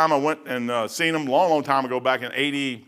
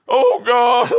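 high, loud wailing "Oh, God"; a pleading, weeping cry to heaven; acted anguished cry; 8 semitones higher, 7 dB louder than usual.

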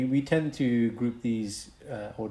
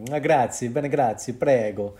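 louder, hammed-up Italian accent; 2 semitones higher, 7 dB louder than usual. Shown as a demonstration. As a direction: put on a punchy Italian voice. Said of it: "could be a bit more Italian".

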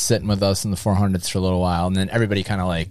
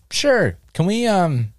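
eager ask; 5 semitones higher than usual. Retold or imitated. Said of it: "And I'm like".

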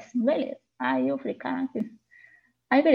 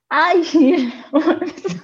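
laughing; 6 semitones higher, 7 dB louder than usual. Real, not acted. Laughing while telling.

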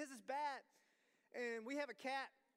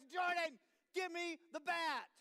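loud, higher, slow shout; shouted command; 7 semitones higher, 8 dB louder, slower than usual. Retold or imitated. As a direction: bark the order at the toddler. Dad shouting at child.

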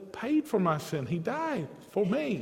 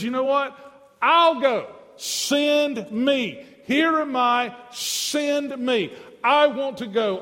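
higher and louder, eager plea; a preacher acting Isaiah, raised and eager; eager Isaiah volunteering; 5 semitones higher, 3 dB louder than usual. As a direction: beg to be sent.

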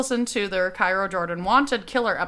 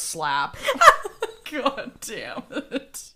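laughing; 6 semitones higher, slower than usual. Real, not acted. Laughing at host's reaction.

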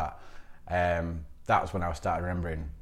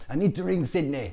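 put-on higher-pitched French accent; French friend's accent; 9 semitones higher, 3 dB louder than usual. French friend decides to phone the council, high French accent. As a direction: imitate a Frenchman speaking English. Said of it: "I had a French friend called Antoine".

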